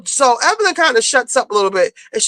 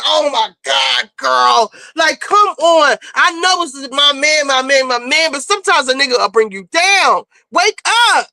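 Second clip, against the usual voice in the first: exasperated plea; 3 semitones higher than usual. Retold or imitated. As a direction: plead with a friend to see sense, emphatically. Acts out exasperated plea to friend.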